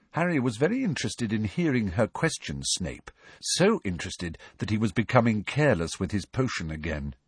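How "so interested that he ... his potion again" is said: usual speaking voice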